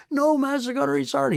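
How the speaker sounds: high mock chorus of Marines; hammed-up high group reply; dopey chorus answer. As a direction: sing it out like dull recruits.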